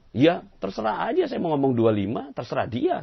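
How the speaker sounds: the man shrugging off the teacher before the judge; careless shrug. Acted. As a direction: toss it off high and indifferent. Other man shrugging off the dispute.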